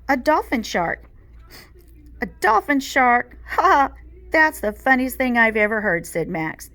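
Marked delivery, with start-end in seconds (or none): 2.2-6.1 higher, louder mocking laughter. A narrator's reading. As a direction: laugh at her answer. Max mocks Chloe's guess.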